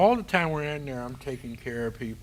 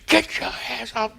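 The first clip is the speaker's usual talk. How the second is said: yelling; 10 semitones higher, 6 dB louder than usual. Retold or imitated. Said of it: "They were cussing at us".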